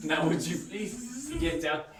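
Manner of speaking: hammed-up fake French accent; a chef in a cheap French accent talking to a fly; bad French accent. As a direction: lay the cheesy French accent on thick. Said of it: "you really want a bad French accent".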